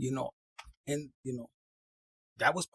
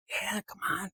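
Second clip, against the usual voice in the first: coaxing whisper; 5 dB quieter than usual. Acted. Coaxing someone in a scene.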